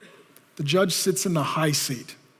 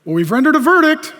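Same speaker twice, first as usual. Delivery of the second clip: smug; 7 semitones higher, 7 dB louder than usual. Acted. Worldly judges answer Jesus.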